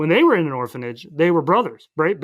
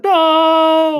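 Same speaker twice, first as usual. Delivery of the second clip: long, loud, high "No!"; anguished mock cry; 12 semitones higher, 8 dB louder than usual. Puss watching Humpty fall, loud dramatic mock cry. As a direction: wail a drawn-out movie-hero no.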